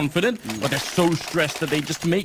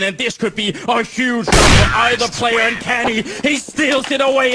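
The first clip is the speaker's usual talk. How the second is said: esports caster; 6 semitones higher, 5 dB louder than usual. Real, not acted. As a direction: call the clutch with rising excitement. Hyped play-by-play of clutch.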